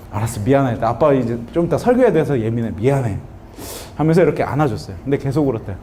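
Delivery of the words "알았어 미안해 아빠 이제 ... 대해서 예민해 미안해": low, hushed retold apology. Father apologises, hugging crying son.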